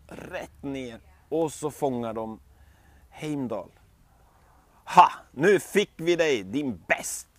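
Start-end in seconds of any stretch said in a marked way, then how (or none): none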